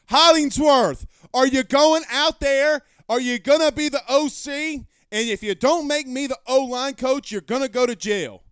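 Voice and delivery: loud, high hammed-up rant at a chat member; playful mock tirade; mock yell. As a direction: bark it out like a furious coach, half joking.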